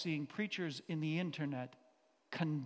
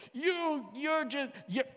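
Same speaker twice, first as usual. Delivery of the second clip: mock preacher; 9 semitones higher, 4 dB louder, slower than usual. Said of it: "he was like".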